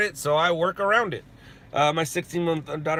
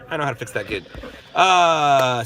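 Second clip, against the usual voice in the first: usual speaking voice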